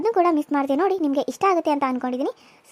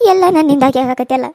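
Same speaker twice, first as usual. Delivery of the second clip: put-on louder grieving old woman; self-blaming old mother; 12 dB louder than usual. Old mother blaming herself for the fight, grieving. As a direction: lament it is all her fault.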